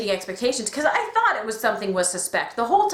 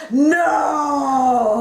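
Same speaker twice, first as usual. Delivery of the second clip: a loud, higher cry of despair; mock anguished no; 4 semitones higher, 5 dB louder than usual. Fan crying out at the reveal, anguished shout. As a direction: wail it like your heart just broke.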